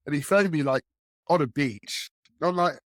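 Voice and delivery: usual speaking voice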